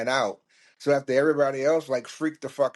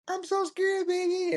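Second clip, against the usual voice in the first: very high squeaky falsetto; hammed-up frightened girlfriend; scared girlfriend. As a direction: whimper in a mock girlfriend falsetto. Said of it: "one of the girlfriends was like".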